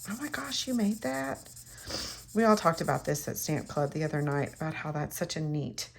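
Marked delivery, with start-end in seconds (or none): 0.1-1.5 soft, high-pitched imitation of amazement; excited amazement. Card recipient marvels at a handmade card, softly amazed. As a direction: quietly mimic a delighted reaction. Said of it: "they get excited".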